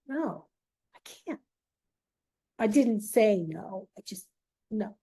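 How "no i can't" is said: strained, barely voiced croak through a lump in the throat; choked whisper. Retelling how she could barely answer her classmate, choked and faint. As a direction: force the words past a tight throat, very soft. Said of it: "tremendous lump in my throat".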